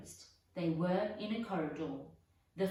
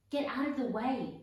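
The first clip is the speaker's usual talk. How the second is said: a loud, higher snap; snapped; 6 semitones higher, 5 dB louder than usual. Acted. Ron snapping at Peeves to get out of the way. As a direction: snap at him to move. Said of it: "snapped Ron".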